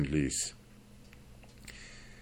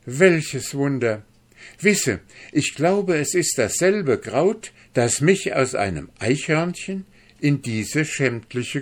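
high, louder voice full of wonder; exclaiming; 7 semitones higher, 4 dB louder than usual. A narrator's reading. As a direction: marvel aloud as the realization hits. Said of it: "rief er endlich aus".

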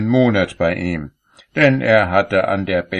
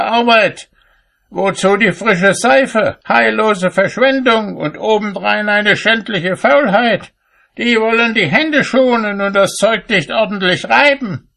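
high, indignant tirade; scolding; 13 semitones higher, 4 dB louder than usual. A narrator's reading. Cobbler to maids chattering at the washtub, high-pitched scolding. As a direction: berate them at a high, insistent pitch. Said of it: "so schalt er sie aus".

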